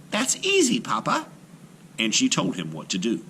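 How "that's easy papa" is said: put-on much higher, light, amused young girl; laughing girl's voice. A narrator's reading. Aisha laughing off her father's worry, high amused girl voice. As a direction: brush it off brightly like a clever daughter. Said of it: "Aisha laughed".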